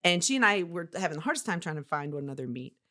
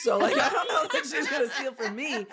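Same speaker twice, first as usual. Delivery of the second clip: higher, laughing retort; 7 semitones higher, 4 dB louder than usual. Retold or imitated. Retelling her reply to the bookkeeper warning, laughing. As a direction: laugh it off as absurd. Jokes she has nothing to steal.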